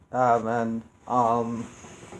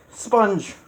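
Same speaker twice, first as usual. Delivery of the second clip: sudden realization; 8 semitones higher, 4 dB louder than usual. Real, not acted. Suddenly remembers rival curser.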